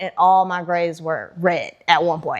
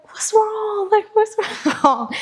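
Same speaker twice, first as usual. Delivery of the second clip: mom's voice, much higher, worried and gentle; 13 semitones higher, slower than usual. Retold or imitated. Mom on the phone asking her crying daughter, high and worried. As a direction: echo a worried mother asking. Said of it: "And she's like".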